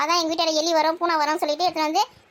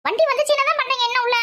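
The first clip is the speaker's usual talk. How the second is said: high, loud call into the house; 7 semitones higher, 3 dB louder than usual. Acted. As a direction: call out to hurry everyone up. Calling the family to hurry. (உரக்கக் கூப்பிடுதல்)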